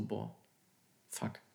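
hushed, stunned thinking aloud; near-whispered disbelief; stunned inner thought. Retold or imitated. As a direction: mutter it to yourself, dumbfounded. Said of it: "dachte ich so".

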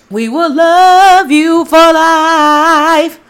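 high, loud, slow and drawn out; grand declaration. Acted. Host tells listeners about Taurus love, grandly drawn out. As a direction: sing out the promise like an anthem.

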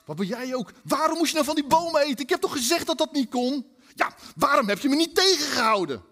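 Adam and Eve bicker about the tree; bickering couple. Retold or imitated. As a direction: snap accusing questions. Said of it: "hebben ze gezegd".

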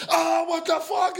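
very high, drawn-out comic wail; hammed-up alarmed cry; mock panic. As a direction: replay the freak-out in exaggerated form. Replaying the panic.

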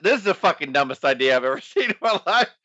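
breaking up into laughter mid-sentence; real helpless laugh through the words; losing it laughing. Real, not acted. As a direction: try to get the line out as laughter takes over. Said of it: "I lost my shit".

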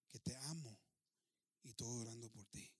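low, soft, unruffled answer; gentle sincerity. Acted. Widow answering the angry chief, gentle sincerity. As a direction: answer the outburst softly and low.

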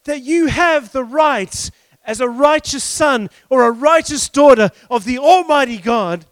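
measured, forceful preacher's declaration; emphatic preaching. Acted. Preacher declaring listeners righteous before God, emphatic preaching. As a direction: proclaim it in weighty, separated phrases.

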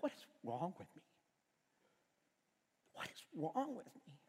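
low, soft, ashamed muttering to himself; hushed self-reproach. Retold or imitated. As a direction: ask it under your breath, dismayed at yourself.

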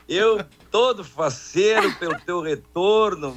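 ordinary talk

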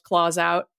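plain reading voice

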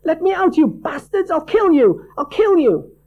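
high, loud enraged shrieking; trapped girl screaming threats in a nightmare; frantic furious screaming. A narrator's reading. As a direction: rage against captors.

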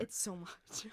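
whispering. Real, not acted. Hushed reaction to glitter picture.

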